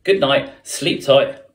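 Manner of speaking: ordinary delivery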